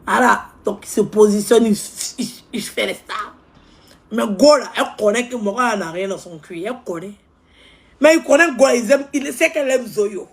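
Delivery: high, loud, fast-paced gossip rant; ranting loud about a show-off; loud animated rant. Acted. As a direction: hype it up, loud and worked up.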